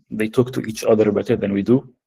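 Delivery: ordinary delivery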